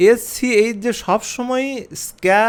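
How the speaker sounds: teacher slowly showing the sound "স্ক্যা"; drawn-out demo. Shown as a demonstration. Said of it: "স্ক এরকম হবে".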